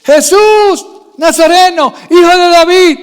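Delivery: loud, high, slow acted shout; imitated beggar's insistent yell; Bartimeo's cry. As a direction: cry out over the crowd, stretching the name. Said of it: "por qué seguía gritando".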